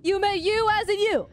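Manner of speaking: mock amazement. Teasing the caller who said me, wailing mock amazement. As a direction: repeat it high and incredulous.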